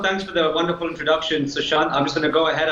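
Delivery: ordinary delivery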